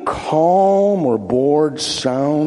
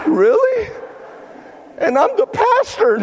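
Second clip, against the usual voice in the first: surprised. Retold or imitated. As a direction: act floored, pitched up. Pastor's retold reply.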